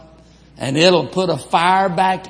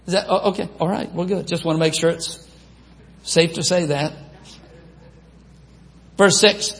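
ordinary talk

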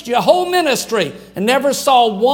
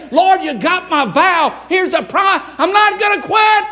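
fervent vow; 5 semitones higher than usual. Retold or imitated. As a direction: vow it to God with everything. Praying a vow on the tracks.

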